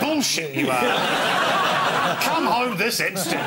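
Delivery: laughing mock telling-off from mum; mock mum on the phone. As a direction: play the scandalised mum down the line.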